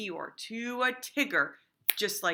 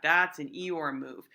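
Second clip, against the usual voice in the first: ordinary talk, nothing marked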